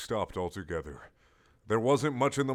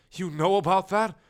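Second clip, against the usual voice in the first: high, loud squeak of alarm; prim castle caught out; shocked castle; 10 semitones higher, 6 dB louder than usual. Acted. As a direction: gasp it out high and scandalised. Castle voice in dialogue.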